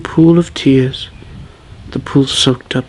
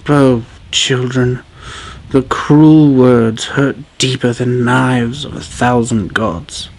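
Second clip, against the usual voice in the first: usual speaking voice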